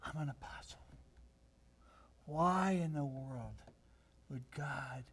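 awed, disbelieving whisper to himself; whispered inner thought. Retold or imitated. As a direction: whisper it as Peter's humbled thought. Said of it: "And Peter thinking".

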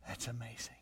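breathless, whispered wonder; a hushed, marvelling aside; awed whisper. Retold or imitated. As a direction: whisper it as if amazed. Paul marvelling at the gospel.

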